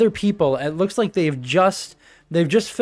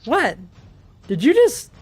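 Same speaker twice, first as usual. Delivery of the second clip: pitched way up, incredulous at the purr; startled disbelief; 9 semitones higher, 3 dB louder than usual. Retold or imitated. GM retelling a player purring in game, high incredulous surprise. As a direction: act stunned that someone just purred.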